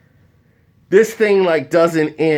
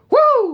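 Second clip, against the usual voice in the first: high whoop. Real, not acted. Celebrating escape.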